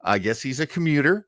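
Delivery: usual speaking voice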